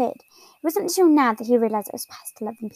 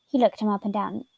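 ordinary delivery, nothing marked

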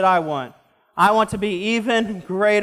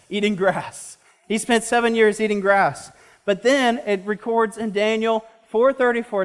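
usual speaking voice

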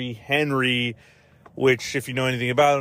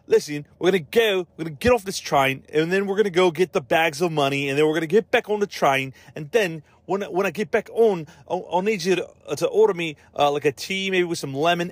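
hammed-up bad British accent, higher pitched; horrible English accent; 4 semitones higher than usual. Reviewer mocking bad actors planning a train job, horrible English accent. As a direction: botch a British accent, letting it slip in and out.

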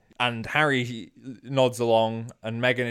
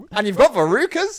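high, loud disbelieving question; shocked disbelief; incredulous; 11 semitones higher, 8 dB louder than usual. Pretend. Incredulous follow-up.

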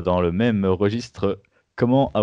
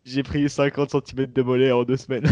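higher, hyped YouTube teaser voice; clickbait video narrator; 5 semitones higher than usual. Pretend. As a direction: sell it like a clickbait ad. Mock clickbait testimonial.

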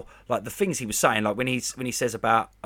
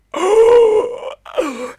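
high, loud thrilled gasp; excited gasp; 9 dB louder than usual. Real, not acted. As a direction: gasp in pure delight. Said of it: "I was like".

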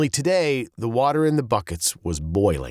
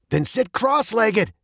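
mild yell; 12 semitones higher than usual. A narrator's reading. Father orders daughter in hot car, mild high yell. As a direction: yell it lightly with raised pitch. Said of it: "yelled her father".

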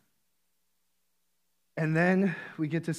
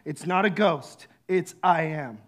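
ordinary talk

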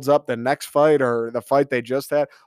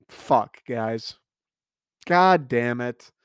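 ordinary talk

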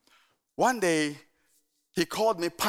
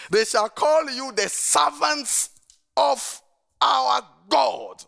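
even slower, higher and louder proclamation; slower scripture reading; 6 semitones higher, 5 dB louder, much slower than usual. Real, not acted. Pastor repeating the verse for emphasis, slow and lifted. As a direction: stretch the verse out, word by word.

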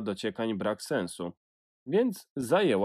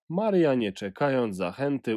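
ordinary delivery, nothing marked

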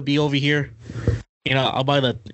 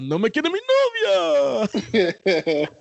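higher, put-on Spanish lament; funny drunk voice; 8 semitones higher, slower than usual. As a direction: whine it like a jilted radio singer. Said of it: "that fucking voice".

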